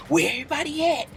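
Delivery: hushed mock bravado; imitated whisper. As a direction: keep it soft and sneaky.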